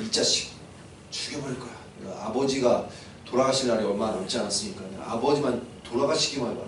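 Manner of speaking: low, quiet menacing threat; suppressed rage. Acted. Elder brother plotting revenge, low suppressed anger. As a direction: seethe under your breath. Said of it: "분노가 극에 달했어요".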